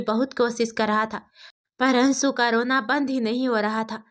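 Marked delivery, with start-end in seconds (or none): none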